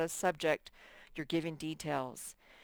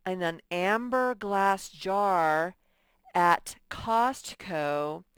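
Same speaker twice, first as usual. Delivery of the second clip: "amber glass jar" and "wild lavender" drawn out slowly; slow detailed version; 5 dB louder, slower than usual. Shown as a demonstration. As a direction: read it slowly, savouring each detail. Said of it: "I could say".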